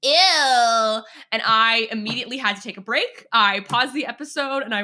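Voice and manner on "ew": loud, drawn-out cartoon disgust; imitated gynecologist's revulsion; mock disgust. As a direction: recoil with a long, exaggerated ew.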